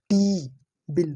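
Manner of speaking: टी with a long ee, बिल with a short i, very slow; slow vowel contrast. Shown as a demonstration. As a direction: drill टी against बिल slowly. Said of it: "clearly differentiate हो रहा है बड़ी ई और छोटी ई".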